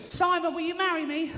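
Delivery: high, squealy mock proposal; high Essex lady voice. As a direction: pitch it up like a giddy fan.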